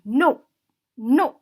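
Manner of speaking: "No" loud with a sharp drop; firm, final "No," no negotiation; commanding fall. Shown as a demonstration. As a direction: order "no" like a full stop. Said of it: "it also sounds like a command".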